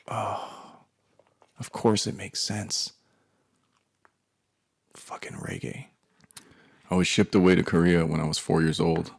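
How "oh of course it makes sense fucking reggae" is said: hushed, slow dawning disgust; muttered realization. Retold or imitated. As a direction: mutter it to yourself as it sinks in.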